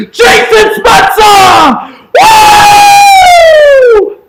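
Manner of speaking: screaming high and loud as the tying goal goes in; ecstatic goal yell. Real, not acted. Fan roaring at the screen for the tying goal, ecstatic yell. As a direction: let it rip, full-throated goal call.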